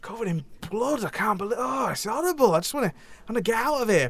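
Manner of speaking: mock panic in a northern English voice; mock horror. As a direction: play at being horrified, northern English. Character describes hellish scene.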